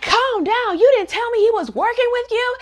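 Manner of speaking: very high, indignant mimicry; indignant retort. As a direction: throw the words back in outraged disbelief. Acted Hana throws words back indignantly.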